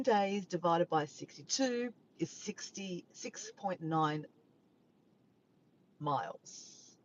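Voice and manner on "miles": ordinary talk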